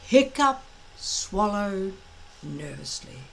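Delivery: ordinary delivery, nothing marked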